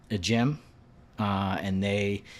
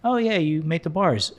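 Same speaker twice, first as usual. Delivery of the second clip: recognizing juice shop owner; 8 semitones higher, 6 dB louder than usual. Retold or imitated.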